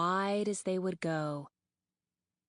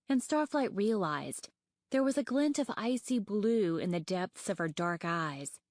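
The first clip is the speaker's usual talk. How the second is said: plain reading voice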